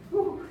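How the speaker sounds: high breathy exhale of relief; winded whew. Real, not acted. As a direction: blow out a tired whew. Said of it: "A little bit out of breath after that one".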